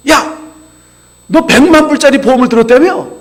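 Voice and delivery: retold angry outburst from an offended friend. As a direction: let the hurt friend bark his grievance. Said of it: "화가 잔뜩 나 찾아왔어요".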